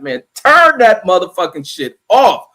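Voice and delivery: loud, drawn-out demand; mock angry yell. Rider fed up with other people's music, mock yell. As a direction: holler it slowly, hammering the words.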